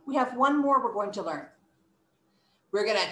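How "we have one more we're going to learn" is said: usual speaking voice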